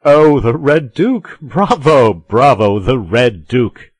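loud, high, drawn-out cheering; delighted cry. A narrator's reading. Porthos applauds Aramis's nickname for the cardinal, crying out in delight. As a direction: applaud the joke with big bravos. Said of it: "cried Porthos, clapping his hands".

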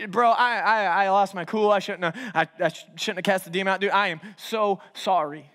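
low, halting remorse; sheepish apology. Acted. Paul apologizing to Silas in jail, low halting remorse. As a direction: stumble through a mock-solemn apology. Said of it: "that's probably the scene".

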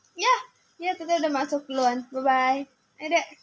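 usual speaking voice

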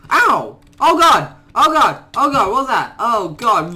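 high, loud frightened cries; panicked yelps. Real, not acted. Gamer attacked from nowhere, panicked yelps. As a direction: yelp in sudden pain and panic.

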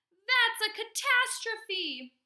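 high, loud, drawn-out wail of outrage; dramatic protest. A narrator's reading. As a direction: protest like a horrified kid. Boy protests losing his toys.

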